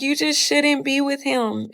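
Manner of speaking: high-pitched mimicked warning; girlfriend's advice retold, pitched well up; imitated girlfriend. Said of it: "She was like".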